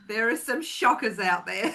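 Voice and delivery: laughing through the words. Real, not acted. Host to guests about Instagram mediums, laughing. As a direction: giggle out the line, amused.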